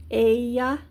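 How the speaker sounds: "Ella" drawn out as "eh-ya"; double L as Y. Shown as a demonstration. Teacher repeating the Spanish word for she, "Ella" said "eh-ya". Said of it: "double L in Spanish is ya".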